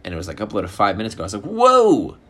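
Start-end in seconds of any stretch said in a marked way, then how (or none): 1.4-2.2 high, loud mock amazement; amazed whoa. Comic re-enacting spotting a meat video, loud high amazement. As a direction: let out a big soaring whoa. Acted reaction to a new video.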